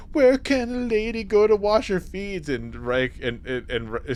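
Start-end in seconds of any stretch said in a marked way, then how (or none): 0.1-2.5 high, lilting mock-Irish lady; coy question in a put-on high voice; imitated Irish lady. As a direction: play the flirting village woman. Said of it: "She lifts up her skirts and he goes".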